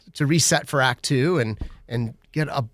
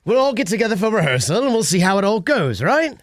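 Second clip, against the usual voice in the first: higher-pitched chatty British, lilting up at the end; British accent; 5 semitones higher than usual. Shown as a demonstration. Director talking rehearsal, British accent. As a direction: play a breezy Brit. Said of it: "Drew, what do you got?".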